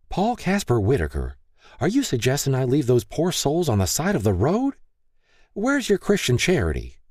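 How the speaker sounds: high, indignant full-name scold. A narrator's reading. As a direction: scold him, outraged. Wife scolds husband by full name.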